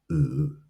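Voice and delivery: "u" held low; third tone. Shown as a demonstration. Teacher modelling tones for repetition, "u" low. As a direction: drop "u" low in the voice. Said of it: "it is a low".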